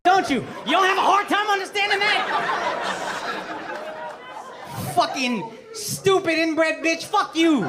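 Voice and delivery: hammed-up angry jab; laughing mock outrage. Real, not acted. Insulting the Queen for the audience, mock-angry laughing jabs.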